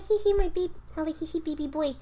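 put-on high, giggly baby talk; cooing at baby. Cooing at baby nephew, high baby talk. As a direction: coo at the nephew. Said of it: "'cause I'm like".

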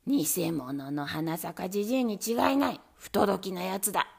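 low, loud, stern rebuke; angry lord. Acted. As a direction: denounce him with lordly anger. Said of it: "ご立腹になって". (低く大きな声で怒る殿様)

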